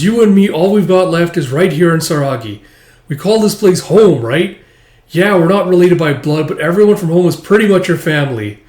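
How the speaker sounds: loud, high, earnest appeal; rally her, heartfelt; impassioned comfort. Acted. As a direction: Alma telling her everyone here is family. Alma consoles her passionately.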